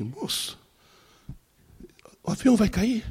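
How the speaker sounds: high-pitched mock worried question; high, anxious retold plea; imitating desperate passenger. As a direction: play a frightened passenger, voice jumping up. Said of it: "ela tava desesperada".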